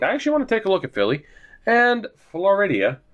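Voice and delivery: usual speaking voice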